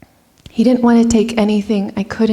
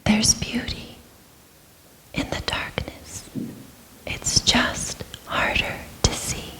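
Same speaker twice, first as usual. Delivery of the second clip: hushed, unvoiced whisper, much softer; 11 dB quieter than usual. Her soul speaks to her through the darkness, whispering. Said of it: "the whispering of my soul".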